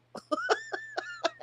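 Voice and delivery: sudden high squealing laugh; real burst of laughter. Real, not acted. Laughs at own stream mistake.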